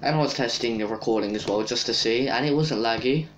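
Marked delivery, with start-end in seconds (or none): none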